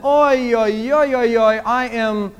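oi oi wail rising into destroyed; lightly voiced Yiddish wail of despair; Yiddish lament. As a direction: wail it like a broken man. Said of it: "There is a Yiddish term that goes".

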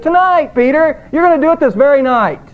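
"Tonight" thrown up high, then driven home fast; Jesus insisting to Peter, with sharp emphasis; emphatic. Retold or imitated. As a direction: press every word of the warning. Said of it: "it's emphatic".

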